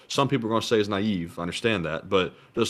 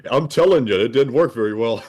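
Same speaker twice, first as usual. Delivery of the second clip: laughing; 2 semitones higher, 5 dB louder than usual. Real, not acted. Laughing about getting sick.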